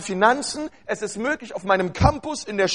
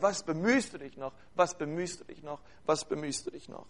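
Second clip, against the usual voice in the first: soft, low repeating murmur; like a broken record playing in the mind; looping whisper of doubt; 6 semitones lower, 12 dB quieter than usual. Preacher imitating the enemy's looped tape, soft and droning. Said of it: "Er drückt auf Repeat".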